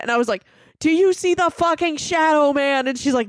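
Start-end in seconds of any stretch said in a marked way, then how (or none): 0.8-2.9 higher-pitched mock panic; retold freak-out. Pointing roommate to the shadow, retold freak-out. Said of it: "like, freaking out".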